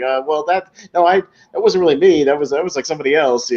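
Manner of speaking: mock flustered, halting backpedal; shaky denial. Nervous Angelo denying the hit on tape, lightly acted fluster. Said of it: "like, shaking, he's just like".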